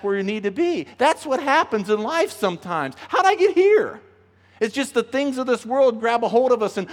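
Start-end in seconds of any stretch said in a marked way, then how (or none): 3.1-4.0 mock surprise. Repeat of the surfer's question, higher.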